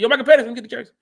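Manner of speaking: calling out for the jersey, half-joking; retold request. Host retelling how he asked the rookie for his jersey, loud and playful. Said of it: "I asked him, I said".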